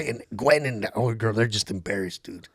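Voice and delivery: ordinary talk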